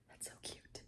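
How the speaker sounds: barely voiced whisper; faint whisper. Real, not acted. Reader swooning quietly, whispering. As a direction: whisper it to yourself.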